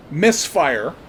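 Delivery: usual speaking voice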